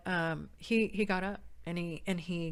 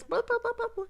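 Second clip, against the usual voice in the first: put-on toddler babble; 4 dB louder than usual. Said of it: "Michael's like this".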